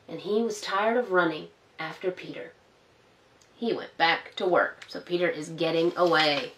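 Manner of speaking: gentle storybook narration for little ones; reading to children. A narrator's reading. Storyteller reading Peter Rabbit to children, gently. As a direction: read it like a bedtime story.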